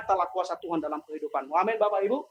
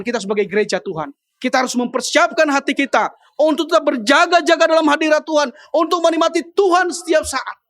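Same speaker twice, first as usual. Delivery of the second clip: raised, loud, emphatic exhortation; full-force preaching; 6 semitones higher, 6 dB louder than usual. Real, not acted. Preacher urging the church to stay watchful, full-force preaching. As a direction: urge the congregation with ringing force.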